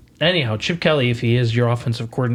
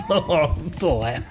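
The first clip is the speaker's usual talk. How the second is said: laughing. Real, not acted. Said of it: "laughing repeat".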